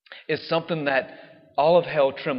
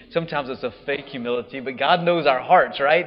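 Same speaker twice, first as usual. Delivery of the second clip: normal talking voice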